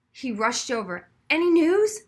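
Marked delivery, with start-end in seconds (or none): 1.3-2.0 anxious eager. A narrator's reading. Frazzled superintendent rushes over.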